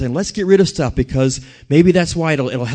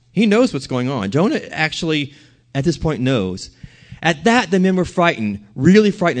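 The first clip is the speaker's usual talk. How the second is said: ordinary delivery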